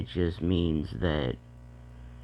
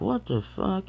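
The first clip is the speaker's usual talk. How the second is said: high shocked parent squeak; 12 semitones higher than usual. Retold or imitated. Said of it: "you're like".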